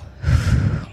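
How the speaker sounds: long audible breath out; relaxed demonstration sigh; demo exhale. Shown as a demonstration. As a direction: let the air go in one breathy sigh. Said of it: "and an exhale".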